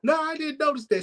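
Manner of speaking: high, whiny helpless voice; mock helpless girlfriend. Girlfriend told he left her, high helpless voice. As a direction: play her high and clueless.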